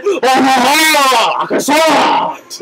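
slow, laughing mock French accent; French Satan voice. French Satan offering a croissant, in a slow laughing mock French accent. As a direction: savor it like a hammy French devil. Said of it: "the French Satan guy".

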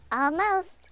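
high, loud almost; exclaiming. A narrator's reading. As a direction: cheer it out. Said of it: "Nancy exclaimed".

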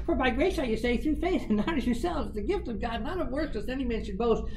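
heard voice. Retold or imitated. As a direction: chant the verse up high. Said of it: "the applause is going".

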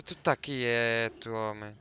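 slow, loud stern order. Real, not acted. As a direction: spell it out to calm the beast. Ordering creature to stay.

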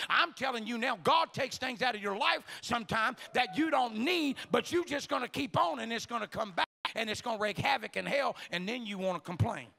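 loud, emphatic sermon delivery; forceful preaching. Real, not acted. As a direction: drive the warning home to the congregation. Preaching rises out of the story.

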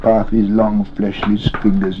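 ordinary delivery, nothing marked

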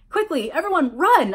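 loud, high warning shout; urgent yell. A narrator's reading. Narrator warning friends as the van attacks, urgent yell. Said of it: "I yelled".

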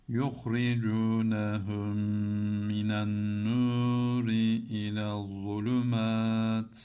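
fast, fluent recitation; fluent quick Quran reading. Shown as a demonstration. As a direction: run the verse off quickly and smoothly. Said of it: "Hemen okuyorum".

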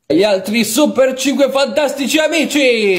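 loud show announcer; annunciatore. Acted. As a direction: introduce the cast in a big voice. Annuncia il cast della puntata. (annunciatore televisivo a voce alta)

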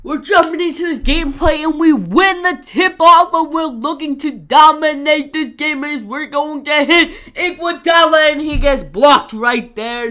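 loud, high play-by-play hype; hyped commentator voice. Acted. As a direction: call the action like an arena announcer. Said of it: "Don't know why I was talking like that".

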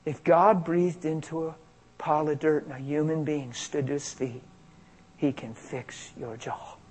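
softly reported thought, sinking away; inner thought. Retold or imitated. Hearing a thought before praying, hushed inner voice. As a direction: let it arrive quietly, trailing very soft. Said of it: "I hear this thought".

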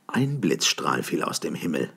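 ordinary delivery, nothing marked

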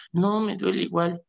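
put-on high, small voice of a hurt little boy; child's voice. Priest retelling a scraped boy's answer, high child voice.

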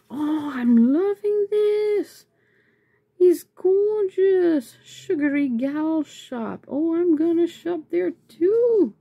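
high, slow, drawn-out delight; smitten, high-pitched enthusiasm; delighted gushing. Real, not acted. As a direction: stretch the words out high as you swoon. Gushing over shop freebies.

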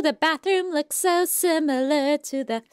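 ordinary talk

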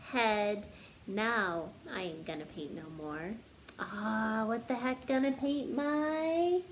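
bouncy storybook narration building up the list; story reading. Acted. Teacher reading a silly picture book to kids, bouncy storytelling. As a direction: read it like a picture book to children. Sings-songy refrain, teasing guesses.

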